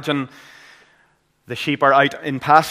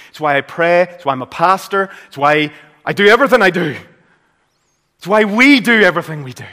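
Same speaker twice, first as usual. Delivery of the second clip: rising, louder preacher emphasis; building preaching; 6 semitones higher, 9 dB louder, faster than usual. Acted. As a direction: hammer each why, faster. Building anaphora in sermon.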